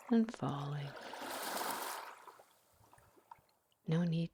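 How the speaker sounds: guided meditation. Acted. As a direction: ease the listener into calm, one breath at a time. Leads a guided visualization.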